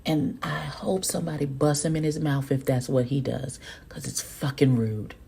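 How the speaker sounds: hushed, breathy aside to viewers; whispered aside. Real, not acted. Telling viewers off-mic that Carl deserves it, whispered. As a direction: whisper it like a secret grudge.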